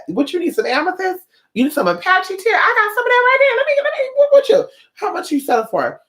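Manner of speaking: high, bright huckster voice pushing the stones; eager crystal seller. Retold or imitated. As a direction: sell the crystals like an excited street vendor. Voicing crystal seller and buyer.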